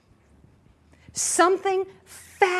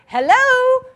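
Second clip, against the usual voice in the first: mock loud hello; 12 semitones higher, 11 dB louder than usual. Mocking the Israelites' complaint.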